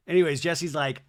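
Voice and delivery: ordinary talk, nothing marked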